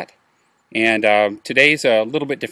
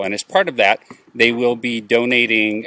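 ordinary talk

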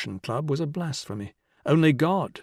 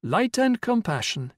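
higher-pitched stage declamation; declaiming verse; 10 semitones higher than usual. A narrator's reading. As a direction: intone it like blank verse from a stage. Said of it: "begun to declaim in blank verse".

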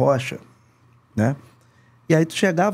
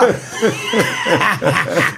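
laughing. Real, not acted. Laughs after the mock yell.

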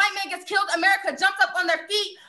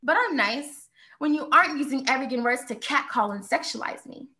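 normal talking voice

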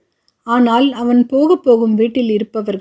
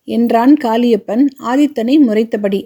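usual speaking voice

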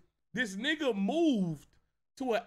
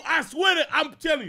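shouting; 6 semitones higher, 7 dB louder than usual. Real, not acted. Shouting in disbelief.

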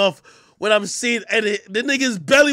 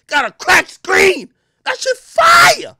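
high, loud, slow excited yell; hyped shout; 7 dB louder, slower than usual. Real, not acted. Hyping cracked phone footage to the listener, in a loud excited yell. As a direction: shout it out, thrilled.

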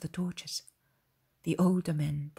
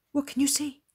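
higher, anxious question, lightly acted; 8 semitones higher, 4 dB louder than usual. Shepherds asking the boy at the hole, anxious. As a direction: ask with mild, eager anxiety. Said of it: "They ask him anxiously in low voices".

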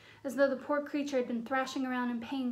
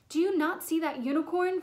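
loud, higher angry challenge; bellowing; 4 semitones higher, 6 dB louder than usual. A narrator's reading. Centaur rounding on Bane over the dead unicorn, bellowing. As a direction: bellow the question at him. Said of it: "Firenze bellowed at Bane".

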